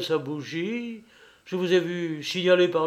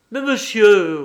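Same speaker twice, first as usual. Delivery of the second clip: proud retort, louder and higher; 4 semitones higher, 9 dB louder, slower than usual. A narrator's reading. Boucha answering the accusation of spying, proudly. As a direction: protest with wounded pride. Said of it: "reprit Boucha fièrement".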